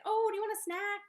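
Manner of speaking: put-on high, loud, sing-song offer to a crying child; sugary distracting parent. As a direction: sweeten it up like you're bribing a toddler. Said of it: "We'll be like".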